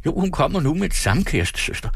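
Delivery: high, startled stutter; flustered stammering. A narrator's reading. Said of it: "stammede han forfjamsket". (forfjamsket stammen)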